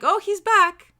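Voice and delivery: high, loud mock surprise; a bright fake gasp of recognition. To the client, noticing the part return, mock surprise. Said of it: "I'm like".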